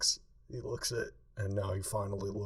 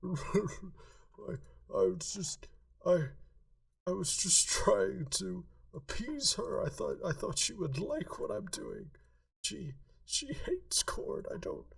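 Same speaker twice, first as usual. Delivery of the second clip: crying, blubbering; 11 semitones higher than usual. Acted. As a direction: blubber it out through tears. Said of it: "Cora just starts fucking crying".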